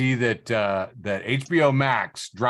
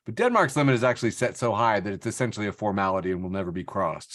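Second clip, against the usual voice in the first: usual speaking voice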